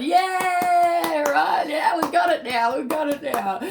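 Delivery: high, loud, cheering voice; cheery Spirit voice. Retold or imitated. Spirit cheering the reader on, high and loud. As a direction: cheer it out like an excited spirit squad. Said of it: "Spirit has just gone".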